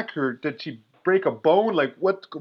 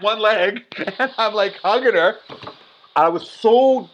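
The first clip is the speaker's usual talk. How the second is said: usual speaking voice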